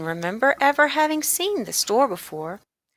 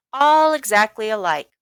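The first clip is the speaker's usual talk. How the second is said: ordinary delivery, nothing marked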